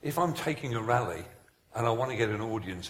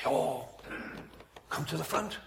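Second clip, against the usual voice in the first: soft altar appeal; 2 semitones higher, 6 dB quieter than usual. Acted. Continues the imitated altar appeal.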